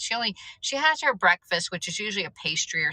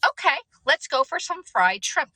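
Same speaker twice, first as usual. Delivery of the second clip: retold reply; 9 semitones higher, 3 dB louder than usual. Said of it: "And I'm like".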